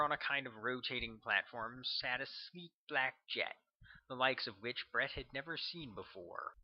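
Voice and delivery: ordinary delivery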